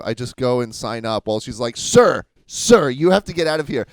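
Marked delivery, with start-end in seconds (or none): 1.8-3.9 raised, higher voice of insistent staff; louder, pitched-up retold order; mock calling-out. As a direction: imitate a staffer calling someone back. Retold staffer calling after her.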